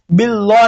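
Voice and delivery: बिल्ला with heavy lam and rounded "o"; बिल्ला darkened, the mistake to avoid; wrongly thickened lam. Shown as a demonstration. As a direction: say बिल्ला as "billo". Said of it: "नहीं पढ़ना है".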